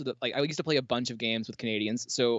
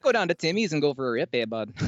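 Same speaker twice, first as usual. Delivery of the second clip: fast, hammed-up Canadian accent; 3 dB louder than usual. Streamer jokes about Tim Hortons, mock Canadian accent. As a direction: play a small-town Canadian buddy. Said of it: "whip out a Canadian accent".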